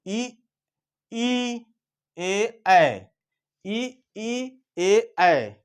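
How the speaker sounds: front vowels ई ए ऐ said slowly and separately; vowel demo. Shown as a demonstration. Teacher sounding out front vowels for students, slow and clear. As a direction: say each vowel apart, clearly. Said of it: "अपने मुंह से उच्चारण नहीं करेंगे".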